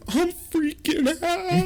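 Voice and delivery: squeaky falsetto freak-out; hammed-up panic, high and drawn out; mock panic. Acts panicked answer to friends.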